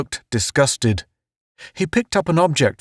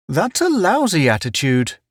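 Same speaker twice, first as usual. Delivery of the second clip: cheerful; 7 semitones higher than usual. A narrator's reading. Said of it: "Town said cheerfully".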